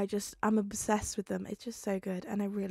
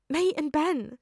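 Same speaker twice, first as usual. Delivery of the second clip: higher and louder, tearful; sniffling answer; 7 semitones higher, 8 dB louder than usual. Acted. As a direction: say it holding back tears. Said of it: "Peter sniffed".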